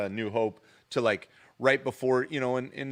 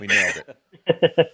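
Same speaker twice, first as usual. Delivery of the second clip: laughing through the words, slowly; 4 semitones lower, 7 dB louder, slower than usual. Real, not acted. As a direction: chuckle it out proudly. Laughing at their clumsy display.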